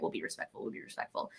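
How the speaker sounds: sheepish whisper of giving in; hushed, chastened agreement; meek whisper. Retold or imitated. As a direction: whisper it like a scolded kid backing down. Relatives backing down, quoted meekly.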